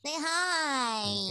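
quiet, tiny imitation of a host; little elf voice. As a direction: mimic her as a little elf. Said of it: "That's like the worst impression of me".

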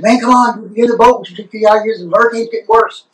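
high, urgent rescuer call; rescuer in a boat. Retold or imitated. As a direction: hurry the man into the boat. Boat rescuer voiced in joke.

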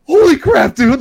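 amazed his buddy stomped it; stunned. Retold or imitated. Reacting to buddy's stomp, high and shocked. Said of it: "I was like".

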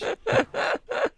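high loud laugh burst; real shrieking laughter. Real, not acted.

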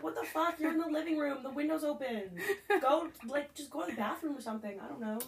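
loud, exasperated telling-off in a retold quote; retold scolding. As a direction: scold your sister in loud disbelief, retelling it. Acted scolding of her sister.

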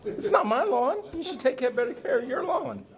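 put-on high cranky old man; sniping back like a grumpy old man; old couple teasing. [name] bickering back in character.

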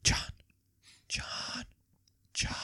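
hushed, near-whispered name calls; gentle waking. Retold or imitated. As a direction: softly rouse a sleeper. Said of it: "be as gentle as possible".

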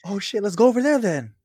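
high and loud, gleeful discovery; mock excited drunk. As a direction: pitch it up like a drunk finding the party. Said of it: "said after laugh".

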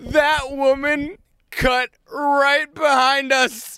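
high, loud and excited; practically shouting the twist; excited retelling. Real, not acted. Telling a friend the best part, high and loud.

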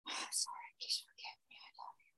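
soft breathy whisper; whispered mantra. Pretend. Parent whispering the mantra as the kid comes. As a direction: murmur it under your breath.

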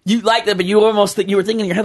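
ordinary delivery

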